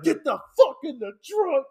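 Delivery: high-pitched gunman's command; rough mock order. Retelling the gunman repeating his order, rough mock order. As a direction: push the order out hard, acted. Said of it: "he's being rough as shit".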